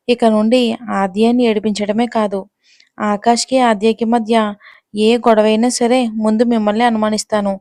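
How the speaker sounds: usual speaking voice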